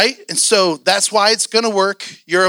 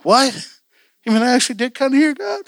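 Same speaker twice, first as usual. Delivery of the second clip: higher-pitched incredulous question, climbing at the end; acted astonishment; 6 semitones higher than usual. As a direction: replay a stunned discovery. Acts out his astonished reaction.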